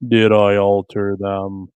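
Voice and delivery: slow, loud and low. Pretend. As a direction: drag it out loudly. Playful mic test, slow loud.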